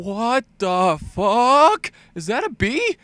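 usual speaking voice

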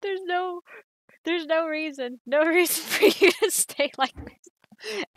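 high, pleading, breaking into laughter; emotional plea. Acted. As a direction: beg her to come back, half laughing. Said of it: "Ace, that was bad".

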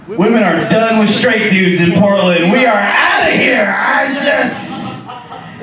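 big booming rant, loud throughout; loud comic rant. Acted. Comic telling the crowd about Portland dating, loud and booming. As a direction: hammer the crowd with it. Stand-up rant at full force.

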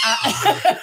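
high, loud laugh. Real, not acted. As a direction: burst out laughing. Laughing mid-story.

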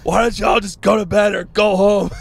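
shouted high and loud like an angry father; furious dad shout. Retold or imitated. Dad yelling at the loud party to go home, shouting. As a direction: yell it like a dad woken at four in the morning. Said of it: "He's so mad".

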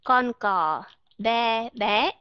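mẹ, be, bé with the open Vietnamese e; teacher drilling Vietnamese e words; Vietnamese e model. Shown as a demonstration. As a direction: model mẹ, be, bé one by one. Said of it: "âm E ở trong Việt Nam đọc là E".

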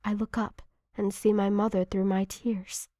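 ordinary delivery, nothing marked

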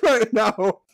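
high, loud, slow laugh-cry. Real, not acted. Tells the girl he cries while laughing loudly. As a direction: claims crying while laughing hard. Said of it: "Why are you laughing at me?".

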